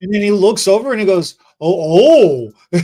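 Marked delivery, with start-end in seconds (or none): 1.6-2.8 loud shocked oh, oh; a friend spotting bad extensions; startled exclamation; mock shock. Buddy's shocked reaction.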